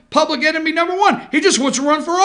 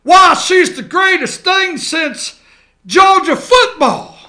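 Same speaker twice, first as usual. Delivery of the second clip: mock gushing Kemp; 5 semitones higher, 6 dB louder, slower than usual. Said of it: "Kemp's running around like".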